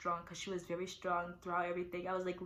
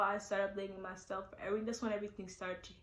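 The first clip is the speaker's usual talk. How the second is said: ordinary delivery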